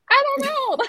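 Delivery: very high squeal into laughter; retold giddy bewilderment; flustered exclamation. Retold flustered answer to mother.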